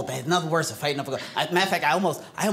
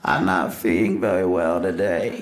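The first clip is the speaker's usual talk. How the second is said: low, goofy cartoon hunter voice; sick-day Elmer Fudd impression; 11 semitones lower than usual. Shown as a demonstration. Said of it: "was Elmer Fudd".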